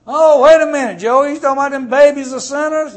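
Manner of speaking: loud, high, incredulous interruption, rushing the end; mock incredulous objector. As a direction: play a skeptic cutting in, fast and disbelieving. Imagined objector interrupts the pastor.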